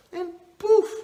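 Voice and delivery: high, loud burst on poof; magic poof. Acted. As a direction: pop it out like a magic spell. Genie pops out of bottle.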